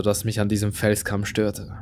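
usual speaking voice

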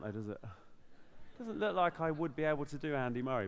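usual speaking voice

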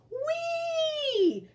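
very high, joyful squeal; gleeful whee. Acted. Jack spinning and squealing whee. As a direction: whoop with glee. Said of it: "cried Jack".

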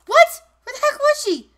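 high, loud startled yelp. Real, not acted. Gamer caught off guard, startled and loud. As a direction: jump at the sudden hit and blurt it out.

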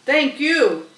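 a proud gander's thanks. Acted. Gander thanking Charlotte, proud and loud.

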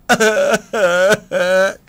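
loud laugh bursting out; burst of laughter. Real, not acted. Risa tras el "sí. (carcajada fuerte)

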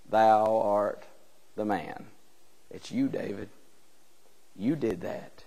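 hushed accusation. Acted. Nathan confronts the king.

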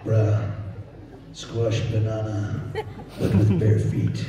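low, slow Capetonian chant; comic Rafiki in a drawn-out Capetonian accent. Acted. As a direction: play a laid-back Capetonian mystic, stretching each phrase. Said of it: "which character shall I do as a Capetonian".

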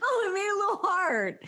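high, squealing through laughter; can barely speak for laughing; laughing hard. Real, not acted. Bursting into laughter at picture.

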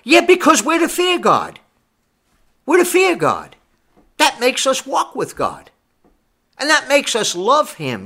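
high, ringing pulpit insistence; emphatic preaching. Real, not acted. Preacher answers an objector about fearing God, emphatic preaching. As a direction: hammer out each short phrase up high.

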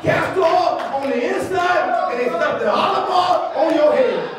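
impassioned pulpit exhortation; full-force preaching. Real, not acted. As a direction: rouse the congregation with driving repetition.

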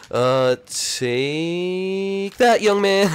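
drawn-out taunt, rising high; mocking taunt. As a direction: stretch it out to tease. Taunting opponent in game.

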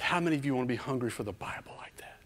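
soft, unvoiced, fast; whispered question. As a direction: whisper it to the kids. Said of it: "I said".

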